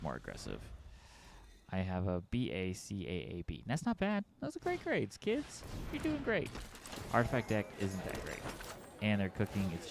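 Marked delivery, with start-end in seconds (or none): 3.7-6.5 put-on much higher, sing-song praise for the kids; proud parent voice. Streamer praises his card-game grades to kids, high and sing-song. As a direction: gush over the report card like a proud mom.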